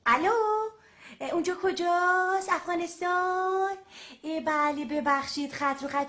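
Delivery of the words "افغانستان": ordinary talk, nothing marked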